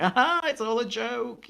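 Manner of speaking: high, slow showman's aha; slow, high mock announcer; mock reveal. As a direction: announce the prank like a gameshow host. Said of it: "come out and be like".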